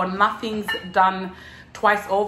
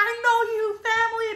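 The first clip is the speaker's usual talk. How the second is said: high mock greeting; 13 semitones higher, faster than usual. Retold family greeting.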